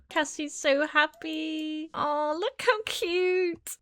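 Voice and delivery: high, soft, slow cooing. Real, not acted. Cooing over baby.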